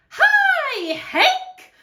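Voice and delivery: very high, loud singsong call. Acted. Tumbleweed greeting Hank the cactus, calling out high and loud. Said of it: "Rosie the tumbleweed called out".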